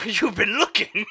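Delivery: laughing accusation. Pretend. Teasing accusation after a gasp.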